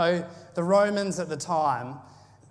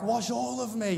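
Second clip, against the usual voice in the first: high, eager mock plea; imitating an overeager disciple; excited Peter; 7 semitones higher than usual. Preacher voicing Peter begging to be washed, eager and high. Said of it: "really excited".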